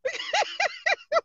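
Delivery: a high, breathless burst of laughter. Real, not acted. Blogger laughing at her own joke, high squeal of laughter.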